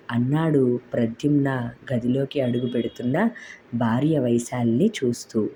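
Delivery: ordinary delivery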